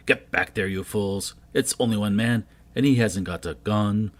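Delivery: loud, furious yelling at the men; angry shout. Acted. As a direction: stop the panicking men with your voice. Leader yelling at fleeing warriors.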